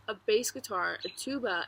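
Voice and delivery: ordinary delivery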